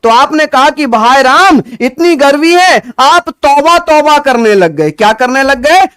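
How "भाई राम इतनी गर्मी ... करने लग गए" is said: louder and higher, a mock groan at the heat; hammed-up heat-struck tourist. A teacher plays a sun-struck tourist for his class, hammed-up moaning. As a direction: play the sweltering tourist moaning.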